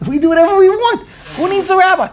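loud, high, fast protest; mock defiant congregant. Congregants rejecting the idea of a rabbi, mock defiant. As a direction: crow it like a rebellious congregant.

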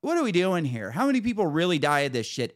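high-pitched incredulous protest; retold outburst of disbelief; exasperated. Patient challenges the provider over pill warnings, exasperated.

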